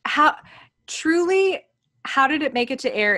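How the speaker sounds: really, how did this happen; indignant disbelief; incredulous. Real, not acted. Incredulous repeat of question.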